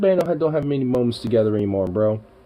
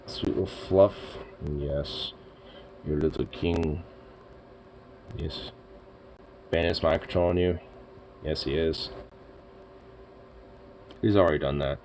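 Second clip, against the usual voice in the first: soft lower pet talk to Bandit; cooing at the cat; 6 semitones lower, 10 dB quieter than usual. Real, not acted. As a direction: coo tenderly to a fluffy cat. Cooing over the cat again.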